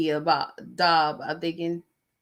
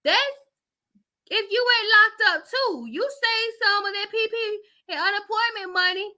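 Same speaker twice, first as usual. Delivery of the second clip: much higher, frantic mock panic; panicking relative; 5 dB louder than usual. Vlogger voices the scared family member, high frantic mock panic. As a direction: squeal it out like she is next in line.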